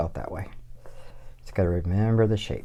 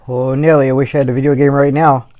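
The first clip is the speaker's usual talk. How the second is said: excited outburst; 4 semitones higher, 12 dB louder than usual. Real, not acted. Artist exclaims while drawing.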